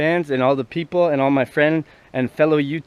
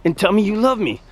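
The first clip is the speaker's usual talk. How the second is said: put-on much higher, whiny little-kid voice; a grown man sounding like a little boy; childlike man; 7 semitones higher than usual. Pete in the car complaining about his dad, high kid voice. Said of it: "He's like".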